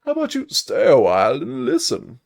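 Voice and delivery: put-on high, loud, swooping old storyteller; hammed-up, loud game-sage impression; Deckard Cain. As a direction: draw the line out with big theatrical swoops. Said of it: "Hold on, Deckard Cain".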